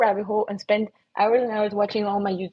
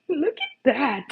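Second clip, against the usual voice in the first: delighted; 6 semitones higher, slower than usual. Real, not acted. Delighted at her own painting.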